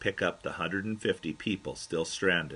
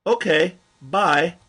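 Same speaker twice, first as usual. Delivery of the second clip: much higher, louder cheery farewell; bright loud goodbye; 10 semitones higher, 9 dB louder, slower than usual. Retold or imitated. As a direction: wave him off cheerfully. Ending puzzling conversation.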